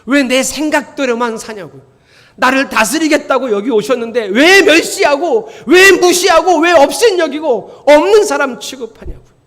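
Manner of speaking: loud, high, building preacher's reproach; escalating full-voiced scolding; fiery rebuke. Real, not acted.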